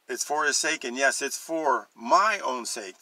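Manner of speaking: normal talking voice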